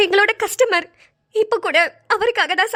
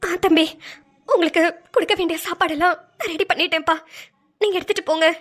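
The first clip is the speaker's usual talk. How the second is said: tearful, halting speech; holding back tears. Acted. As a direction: carry on talking while trying not to cry. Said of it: "ஏன் அழுதுட்டு இருக்கீங்க". (அழுகையை அடக்கிக்கொண்டு)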